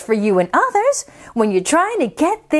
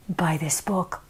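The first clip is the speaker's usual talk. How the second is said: whisper; 10 semitones lower, 10 dB quieter than usual. Shown as a demonstration.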